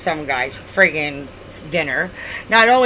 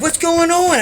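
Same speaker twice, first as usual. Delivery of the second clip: high, louder, startled shout; alarmed cooks; 12 semitones higher, 5 dB louder, slower than usual. Retold or imitated. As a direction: burst out like you heard a crash. Cooks run out after crash.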